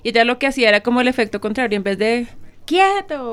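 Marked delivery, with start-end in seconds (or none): 2.6-3.3 high, sharp mock shout at a child; imitated yell. Showing how a mother would shout at her children, yelled. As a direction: demonstrate yelling an order, pitched well up. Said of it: "o gritarlo".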